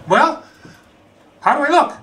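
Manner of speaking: high, coy showing off; coy how do I look. Retold or imitated. To roommate after stuffing socks, coy and high. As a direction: pose and ask sweetly.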